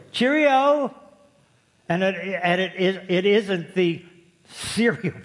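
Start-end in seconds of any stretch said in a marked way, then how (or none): 0.1-1.0 high, sing-out mock-English call; put-on cheery English greeting; hammed-up English salutation, pitched high; English imitation. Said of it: "The English have this saying".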